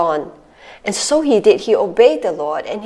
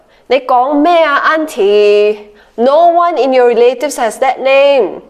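Cantonese-English mix; 3 semitones higher, 4 dB louder than usual. Retold or imitated. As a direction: scold like a Singapore auntie. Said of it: "relatives object in local speech".

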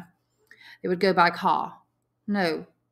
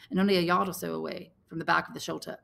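plain reading voice

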